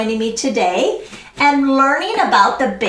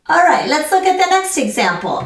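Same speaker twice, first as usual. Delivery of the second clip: usual speaking voice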